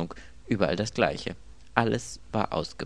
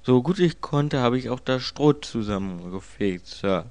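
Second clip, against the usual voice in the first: higher, louder manservant voice; obliging servant, pitched up; deferential servant; 5 semitones higher, 4 dB louder than usual. Acted. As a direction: report dutifully to the master.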